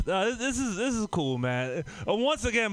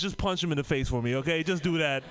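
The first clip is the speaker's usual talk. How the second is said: ordinary delivery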